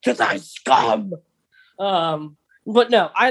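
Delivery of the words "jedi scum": slow, lightly voiced raspy cyborg villain sneer; Grievous. Cohost prompts him, he answers as a mild mock Grievous. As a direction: drag out a menacing hiss of contempt. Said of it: "I sound like Grievous over here".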